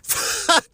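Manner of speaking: a quick high airy laugh cutting in; breathy laugh. Real, not acted. Pizza finally slips off, quick high airy laugh. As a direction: snort a laugh mid-sentence.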